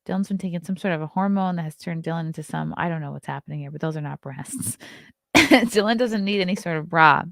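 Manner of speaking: ordinary delivery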